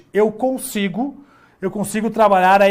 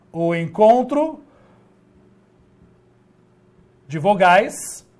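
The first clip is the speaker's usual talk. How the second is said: slow, word by word, with long pauses; slow dictation. Shown as a demonstration. Teacher dictating the definition to the class, slow and spaced. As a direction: dictate it slowly for students to copy. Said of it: "teacher dictating the definition slowly".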